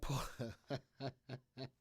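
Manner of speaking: long breathy run of chuckles; helpless staccato laughing; amused laugh. Real, not acted. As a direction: crack up at your own joke. Laughs at own impression.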